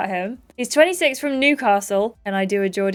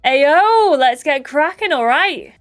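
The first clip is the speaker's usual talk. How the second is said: loud, high opening whoop, easing off; high-energy entrance; 4 semitones higher, 5 dB louder, slower than usual. Acted. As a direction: burst in as a high-energy lad. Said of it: "He does seem very high energy".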